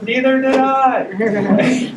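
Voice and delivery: high, loud, drawn-out mock exclamation; excited retold reply. Speaker retelling patient's surprise at herself, high excited imitation.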